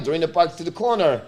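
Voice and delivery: usual speaking voice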